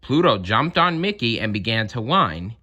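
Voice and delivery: ordinary delivery, nothing marked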